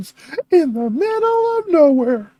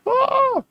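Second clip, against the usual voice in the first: mock scared cry; 10 semitones higher than usual. Real, not acted. Startled cry.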